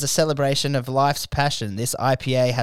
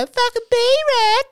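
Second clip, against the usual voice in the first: very high, slow, louder squeal; mocking high pitch; 7 dB louder, much slower than usual. Bullies taunt him with his nickname in a slow high squeal. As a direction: drag the nickname out in a squeaky falsetto. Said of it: "They used to go".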